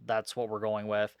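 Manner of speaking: ordinary talk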